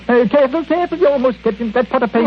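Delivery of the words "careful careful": urgent warning. Acted. Warning Watson of paint pot.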